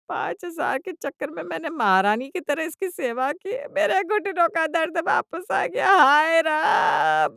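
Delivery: loud, high hammed-up wail; wailing lament. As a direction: melodramatic mother-in-law bawling. Mother-in-law's final wailing lament.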